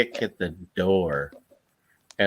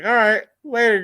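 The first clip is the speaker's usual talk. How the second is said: cheery goodbye; 12 semitones higher, 6 dB louder than usual. Retold or imitated. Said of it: "I was like".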